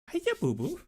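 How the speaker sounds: put-on high, soft cooing baby talk. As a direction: coo it like talking to a baby. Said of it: "did you guys hear me baby talking Gina".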